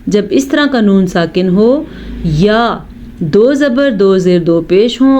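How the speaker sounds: ordinary delivery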